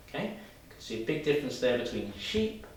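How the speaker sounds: ordinary delivery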